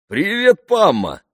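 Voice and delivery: high, loud, joyful hail; delighted greeting. A narrator's reading. Jeremy spotting Pamela at the field, delighted greeting. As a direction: greet her with open delight. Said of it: "обрадовался Джереми".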